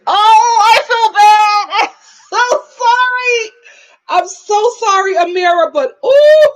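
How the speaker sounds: laughing, over-the-top apology; guilty outburst. Real, not acted. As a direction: wail it with amused guilt. Guilty outburst about mocking the accent.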